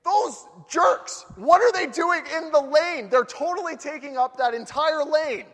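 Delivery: high, loud mock fury; road rage thought. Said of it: "I think".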